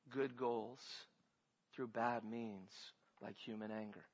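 very soft, low voice with long pauses; hushed, low and unhurried, as a private resolution; quiet resolve. Acted. Preacher voices a humble listener's resolve to the congregation, quiet and low.

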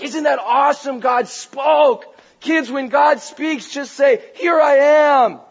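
put-on high, loud children's-lesson enthusiasm; excited rallying. Teacher rallying kids to answer God, high and excited. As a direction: build to a big, drawn-out finish.

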